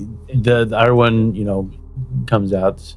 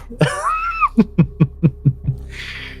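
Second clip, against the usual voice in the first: shrieking laugh; 5 dB quieter than usual. Real, not acted. GM reacts to the pun.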